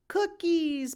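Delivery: high excited squeal; excited little girl shouting for cookies; child's scream. A narrator's reading. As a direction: shriek it like a toddler at the sight of cookies. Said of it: "Penny screams".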